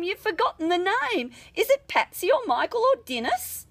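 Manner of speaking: lightly voiced priest, rising high and eager; a merry priest giving orders, building up; jovial Irish priest. A narrator's reading. The joking priest directs the parents at the log, lightly voiced.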